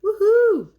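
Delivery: high, loud victory whoop; gleeful whoop. Retold or imitated. Voicing Molly Lou cheering after her touchdown, gleeful whoop. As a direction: whoop like you just scored. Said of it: "She's like".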